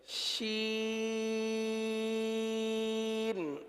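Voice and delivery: شین long and soft, the sound spreading inside the mouth; Quranic Sheen. Shown as a demonstration. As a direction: draw out شین with the hiss held in the mouth. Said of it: "منہ کے اندر بھی کچھ آواز رہتی ہے". (قرآن والا شین، آواز منہ کے اندر پھیل کر)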